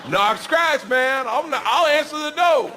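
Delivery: high, loud teasing call; joking call-out. Retold or imitated. Hotel staff teasing the comedian, loud and high. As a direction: tease him loudly with the catchphrase. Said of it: "they're like".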